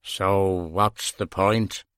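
low, slow dim-witted voice; dull man voice. Acted. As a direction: plod through it like a dull man. Dull DS Quinn asks.

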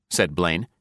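ordinary delivery

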